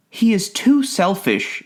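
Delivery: slow, high-pitched female character voice; Autumn's verdict. A narrator's reading. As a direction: pass judgment in a high, drawn-out voice. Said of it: "she said".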